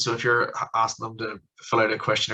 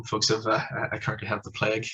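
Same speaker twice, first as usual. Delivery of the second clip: usual speaking voice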